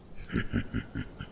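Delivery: soft, breath-only chuckle; knowing dragon chuckle. Acted. Dragon amused by the visitor's seeking, hushed chuckle. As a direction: chuckle quietly, as if keeping a secret.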